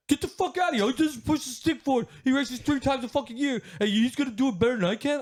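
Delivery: very high-pitched, hammed-up ranting; imitating furious dad. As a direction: shout like an indignant, insulted father. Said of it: "He was so mad".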